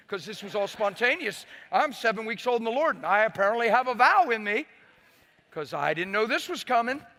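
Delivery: loud, high preacher's exclamation; impassioned preaching. Real, not acted. Preacher telling congregation of his spontaneous vow, impassioned.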